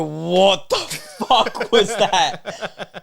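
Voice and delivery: mock amazed. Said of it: "They go".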